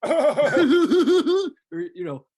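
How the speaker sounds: high, loud cackling laugh; freaky clown laugh. Retold or imitated. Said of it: "one of those laughs that goes like".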